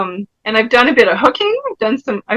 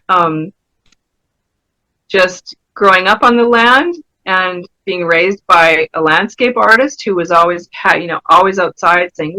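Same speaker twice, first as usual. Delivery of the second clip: ordinary talk, nothing marked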